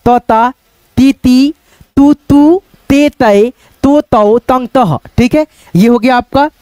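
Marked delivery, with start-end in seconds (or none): 0.1-5.0 drawn-out, sing-song recital of each syllable; syllable drill. Shown as a demonstration. Teacher reciting the ज syllable chart to students, sing-song. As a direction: chant the barakhadi one by one for pupils. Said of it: "बैठाइए आप लोग खुद से भी".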